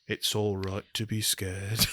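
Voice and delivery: imitating Robert Downey Junior's mumbled Welsh accent; bad Welsh accent. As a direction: do a shaky Welsh accent badly. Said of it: "this strange Welsh accent".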